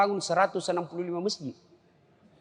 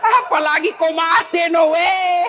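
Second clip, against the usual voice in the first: louder, higher, laughing mock declaration; laughing shout; 12 semitones higher, 7 dB louder, slower than usual. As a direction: blurt the punchline through a laugh. Joking reply to wife. (teriakan bercanda sambil tertawa)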